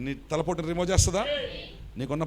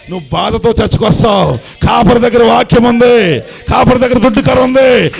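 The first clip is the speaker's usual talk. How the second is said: louder and higher, then a shout; building sermon fervor; 6 semitones higher, 16 dB louder than usual. Real, not acted. Pastor comforting the church, building to preaching fervor. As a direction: rise to a fervent shout.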